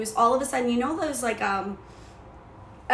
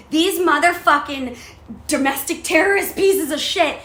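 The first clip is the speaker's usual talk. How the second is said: higher, louder outraged tirade; indignant fury, pitched up and loud; retold paranoid rant; 5 semitones higher, 6 dB louder than usual. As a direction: rant like you've caught the thieves red-handed. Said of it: "I was a psycho fucking mindset".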